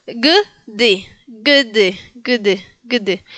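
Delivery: "g d" as hard g straight into d; G with no vowel. Shown as a demonstration. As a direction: model "g d" slowly, no vowel after the g. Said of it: "solamente digo la G". (la "g d" con g de gato, sin vocal)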